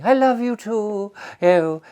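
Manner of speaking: hammed-up posh lady farewell; lovestruck lady. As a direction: sigh it like a smitten woman. Woman caller's farewell.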